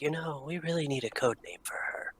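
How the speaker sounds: hushed aside to a friend; whispering. Acted. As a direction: lean in and whisper it. Leans over to whisper to Pavu.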